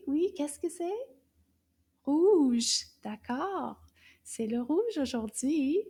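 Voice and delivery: ordinary talk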